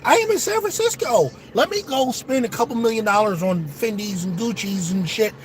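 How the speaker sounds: very high, airy mock rich-girl voice; mock Caitlin Clark. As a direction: squeak it out like a spoiled shopper. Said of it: "Maybe Caitlin Clark was like".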